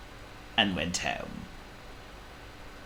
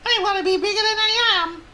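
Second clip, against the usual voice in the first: very high, loud wailing tiny mouse; Squeak; 6 dB louder, faster than usual. A narrator's reading. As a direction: squeal it as a tearful little mouse. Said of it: "said Squeak".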